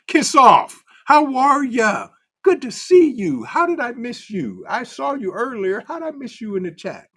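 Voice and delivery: big high hello, loud at first; excited greeting. Real, not acted. Excited greeting to arriving viewer.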